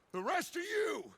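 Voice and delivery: a leader's booming order to ride; rallying shout. Acted. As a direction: rouse the whole gang to move.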